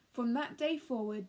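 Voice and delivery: ordinary delivery, nothing marked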